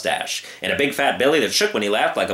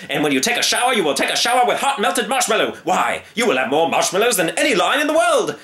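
eager showman; 8 semitones higher than usual. Acted. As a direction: sell him the dream. Circus man gushing.

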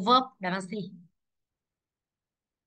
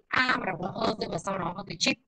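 ordinary delivery

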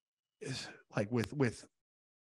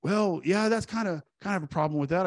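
higher, awkward father hedging; lightly voiced uneasy dad, pitched up; hesitant dad voice; 7 semitones higher than usual.

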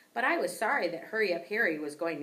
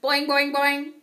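loud, high springy boings; ping the boings wildly; upset twang; 8 semitones higher, 11 dB louder, slower than usual. A narrator's reading. Frog splashing in distress, loud springy boings.

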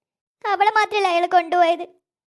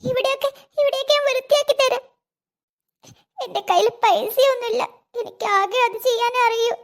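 frightened pleading; 3 semitones higher than usual. Acted. Girl begs the captor.

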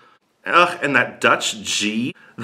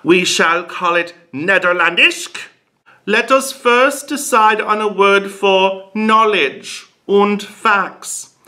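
high-pitched mock German farmer; hammed-up German accent, pitched up; 6 semitones higher than usual. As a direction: proclaim in a raised German-accented voice. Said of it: "medieval German farmers".

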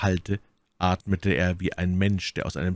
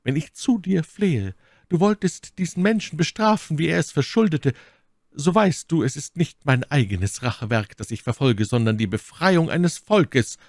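high, impassioned prayer; fervent Milady; 9 semitones higher, 5 dB louder than usual. A narrator's reading. Milady prays aloud for Buckingham's punishment, fervently imploring. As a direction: implore heaven with raised, emphatic voice. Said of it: "rief My Lady".

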